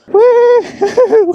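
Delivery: high joyful woohoo; excited whoop. Real, not acted. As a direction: whoop through the air. Whoop at pit bike track.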